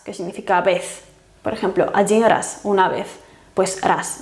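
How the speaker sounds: normal talking voice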